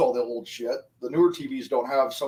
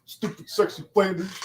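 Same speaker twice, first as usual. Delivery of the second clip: Simpsons quote; 5 semitones higher than usual. Retold or imitated. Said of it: "Open up Flanders box".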